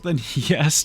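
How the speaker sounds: usual speaking voice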